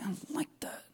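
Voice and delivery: soft unvoiced whisper; God quietly approving Moses, whispered; whispered approval. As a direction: breathe it as a pleased secret.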